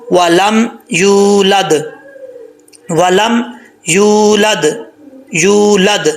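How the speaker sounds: یولد with long یو; slow chanted یولد; tajweed recitation. Shown as a demonstration. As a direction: stretch یو in یولد slowly. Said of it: "لمبا کر کے پڑھیں گے".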